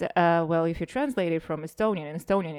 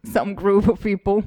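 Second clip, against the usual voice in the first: laughing; 3 semitones higher, 7 dB louder than usual. Real, not acted. Jokes about being an ambassador.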